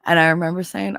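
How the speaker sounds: ordinary talk, nothing marked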